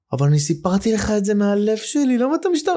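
high, pleading hurt partner; hurt plea. Retold or imitated. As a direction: plead like the wounded one in a fight. Voicing hurt partner in quarrel.

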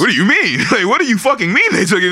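hammed-up exasperated what do you mean; incredulous mock retort. Friend mocking the king, incredulous and high. Mocking the king's reaction, retold.

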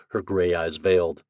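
ordinary delivery

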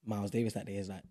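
normal talking voice

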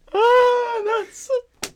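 high, loud mock squeal of delight; Facebook mom laughing. Mocking a Facebook mom who loves a wine joke. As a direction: cackle like a mom at a meme. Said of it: "she's just like".

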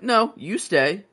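lightly acted stubborn friend; defiant refusal. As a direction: plant your feet and refuse. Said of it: "She's like".

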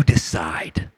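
hushed, slow whisper. Acted. Preacher whispering to the congregation, slow hush. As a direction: lean in and whisper it slowly. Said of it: "whispered punchline".

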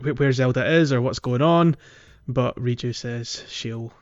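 ordinary talk

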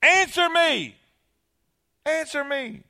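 high insistent demand, fading; boy getting mad at the echo. Acted. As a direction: demand an answer, childish anger. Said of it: "Now he's getting mad".

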